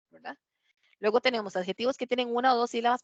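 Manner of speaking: ordinary talk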